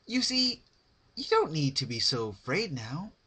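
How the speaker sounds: high, loud, sugary cheerful pony voice; Pinkie Pie voice. Acted. Narrator voicing Pinkie to terrified Rarity, high cheerful. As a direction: chirp it brightly, creepily sweet.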